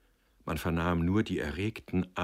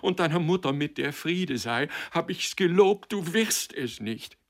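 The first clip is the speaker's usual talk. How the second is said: high, loud impassioned tirade; desperate, raised-voice vow; wild cry; 10 semitones higher, 6 dB louder than usual. A narrator's reading. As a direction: pour it out frantically. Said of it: "rief sie wild".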